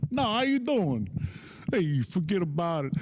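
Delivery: lightly hammed-up New York wise guy; New York catchphrase swagger; New York accent. Opens set with New York catchphrases.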